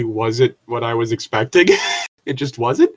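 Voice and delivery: high incredulous question through laughter; can't believe it, laughing; laughing disbelief. Real, not acted. Host laughs in disbelief at a comment.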